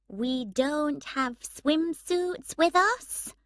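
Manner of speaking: slow, high, overly patient voice; talking down to a child. A narrator's reading. Emma speaking to Dexter as to a young child, slow and patronising. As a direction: spell it out like to a small child. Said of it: "speaking in the way people speak to young children".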